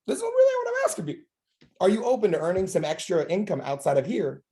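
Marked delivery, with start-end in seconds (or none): 0.0-1.2 high aside. Real, not acted. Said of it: "aside to audience".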